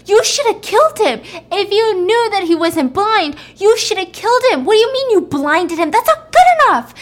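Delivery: high, loud angry scolding; pissed. Retold or imitated. Bad cop berating his accomplice, pissed and loud. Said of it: "he's pissed. He's pissed".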